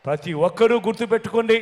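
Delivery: usual speaking voice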